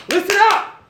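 high, ringing call to attention; sharp, high call to listen up; preacher's call. Shown as a demonstration. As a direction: call the room to order like a preacher. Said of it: "if I was preaching and I said".

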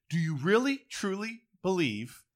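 ordinary delivery

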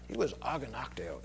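"aganakteo" said softly and huffily; indignant sounding word. Shown as a demonstration. Preacher shows how the Greek word sounds like its meaning: huffy. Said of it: "Doesn't that sound like it?".

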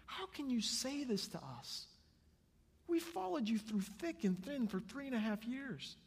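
high-pitched, hurt protest; shocked disbelief. Acted. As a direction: object as a stunned follower would. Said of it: "they all had to be shocked".

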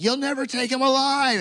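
high, defiant battle cry; defiant Peter. Retold or imitated. Peter facing the guards, high defiant cry. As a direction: shout it like a hothead hero. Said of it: "Peter is like".

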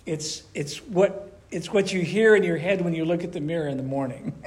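ordinary talk, nothing marked

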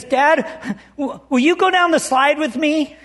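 a hint of a child asking for help; pleading little boy. Small son asking his father down the slide, lightly voiced pleading. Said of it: "and, and David says".

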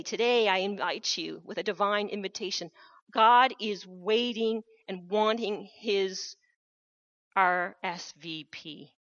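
ordinary talk

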